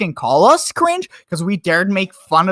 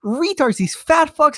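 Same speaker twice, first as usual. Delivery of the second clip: usual speaking voice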